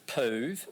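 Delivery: "poov" with the long OO of "move"; rhymed with move. Shown as a demonstration.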